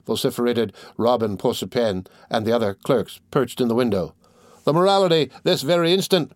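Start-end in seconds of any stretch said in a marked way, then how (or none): 4.6-6.3 crowd demanding. A narrator's reading. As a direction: demand it at once, raised. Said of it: "repeated the crowd".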